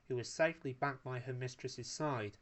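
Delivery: ordinary delivery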